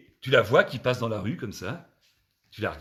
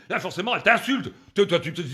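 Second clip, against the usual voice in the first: ordinary talk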